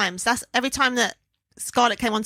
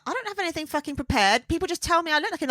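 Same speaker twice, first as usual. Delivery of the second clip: mock protest; 7 semitones higher than usual. Acting out flustered contestant's protest.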